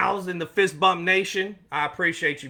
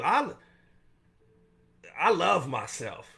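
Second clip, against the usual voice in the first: ordinary talk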